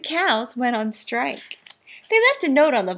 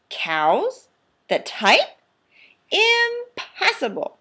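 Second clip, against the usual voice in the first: disbelief; 8 semitones higher, slower than usual. Acted. Farmer disbelieving.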